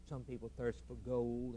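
normal talking voice

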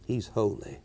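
a reverent murmur; solemn hush. Real, not acted. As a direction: let it fall quietly. Repeated solemn refrain.

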